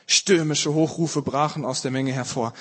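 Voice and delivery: plain reading voice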